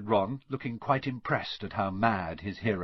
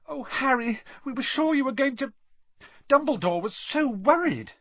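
put-on very high-pitched, breakneck girlish worry; fretful, emotional young friend; anxious gushing; 3 dB louder than usual. A narrator's reading. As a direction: tumble the words out in relief. Girl gushes with relief, worry.

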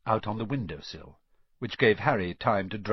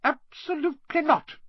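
very high-pitched, firm refusal; starchy matron saying no; strict matron; 4 dB louder than usual. A narrator's reading. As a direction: forbid it as a scandalised nurse.

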